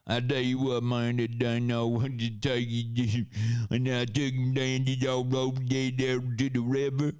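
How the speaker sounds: high, monotone hillbilly mumble-jumble; unintelligible hillbilly. Retold or imitated. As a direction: run the words together in a hillbilly accent. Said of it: "T J's doing his unintelligible hillbilly shit".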